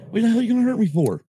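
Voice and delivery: high, fast, outraged "what the hell"; indignant outburst. Retold or imitated. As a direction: fire back in mock outrage. Retorting to the daughter's quoted threat.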